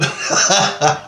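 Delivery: short loud breathy laugh. Real, not acted. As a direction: burst of real laughter. Laughing at his own tale.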